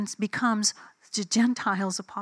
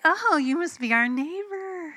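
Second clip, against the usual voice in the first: high, loud fake-friendly greeting with attitude; exaggerated high singsong, attitude showing through; retold attitude; 9 semitones higher, 5 dB louder than usual. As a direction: greet them loudly, high-pitched and full of attitude. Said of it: "I can feel my attitude".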